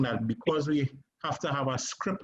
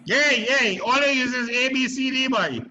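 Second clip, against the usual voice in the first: excited; 11 semitones higher, 5 dB louder than usual. Shown as a demonstration. Emotional alphabet acting exercise.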